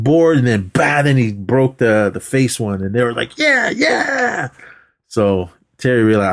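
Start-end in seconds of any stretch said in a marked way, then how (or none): 3.4-4.5 high-pitched imitation of excited cheering; drawn-out high cheer, retold; mock cheering. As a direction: whoop it up like the onlookers. Imitating people cheering a board break.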